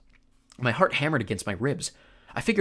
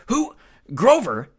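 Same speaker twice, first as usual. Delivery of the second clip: shouted disbelief; 10 semitones higher, 9 dB louder, slower than usual. Acted.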